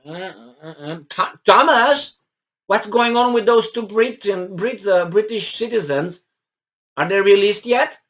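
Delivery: imitating Boris Johnson. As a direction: play a distracted leader fumbling for names. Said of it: "I bet he was like".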